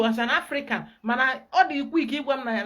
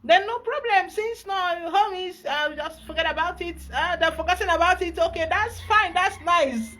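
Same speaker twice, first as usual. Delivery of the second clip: mock cheerful dismissal; 8 semitones higher, 5 dB louder than usual. Mocking the client's easy dismissal.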